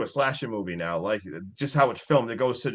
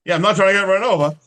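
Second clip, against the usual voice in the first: excited; 7 semitones higher, 8 dB louder, faster than usual. Pretend. Echoing co-host's line loudly.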